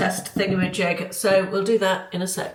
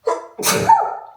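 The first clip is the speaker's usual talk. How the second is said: sneezing; 4 dB louder than usual. Real, not acted. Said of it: "excuse me, I'm gonna sneeze".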